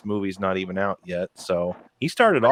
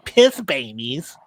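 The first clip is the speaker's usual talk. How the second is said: mocking voice; 7 semitones higher than usual. Mocking Taika's defiance.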